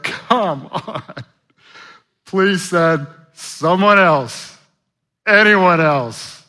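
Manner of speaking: slow, high, drawn-out whine; mocking Moses. Pastor mimicking Moses begging God to send another, slow and high. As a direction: stretch the plea out mockingly. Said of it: "It's like".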